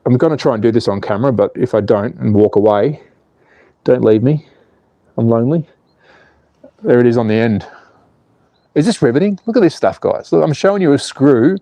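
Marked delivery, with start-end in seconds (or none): none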